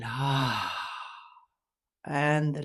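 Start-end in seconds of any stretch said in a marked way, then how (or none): 0.0-0.8 long, breath-filled "ah" of release; sighing exhale. Shown as a demonstration. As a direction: sigh it all out through an open mouth. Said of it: "And a sigh on the exhale".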